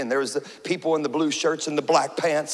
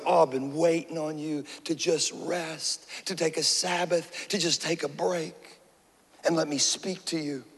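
tender God voice; 7 dB quieter than usual. Acted. God gently inviting rest.

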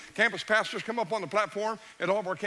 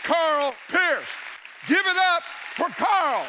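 loud, high and slow, rallying the crowd; rousing call for applause; 8 semitones higher, 5 dB louder, much slower than usual. Real, not acted. Pastor calling the congregation to applaud Carl, rousing shout. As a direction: pull the room into cheering.

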